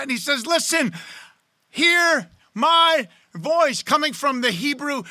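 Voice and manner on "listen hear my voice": "hear my voice" drawn out slowly, each word raised; emphatic exclamation. Acted. David's exclamation point to God, "hear my voice" slow and emphatic. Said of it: "that was emphasis on these words".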